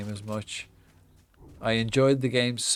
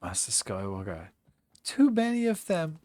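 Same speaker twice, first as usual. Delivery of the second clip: Star Wars character voice; 11 semitones higher than usual. Pretend. Star Wars voice during play.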